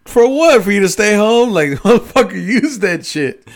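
loud, high disbelieving laugh-shout; incredulous outburst. Real, not acted. Friend scolding him for staying home, loud incredulous outburst. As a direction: scoff loudly at a wasted vacation.

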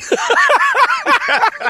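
high burst of laughter; big laugh. Real, not acted. Host cracking up retelling the apology, high burst of laughter. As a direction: crack up hard.

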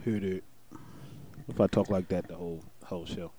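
low, flat, quiet "hit it"; low deadpan mumble. Pretend. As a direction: mumble it low like a bored hype man. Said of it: "You just gonna say "hit it," or you gonna actually talk?".

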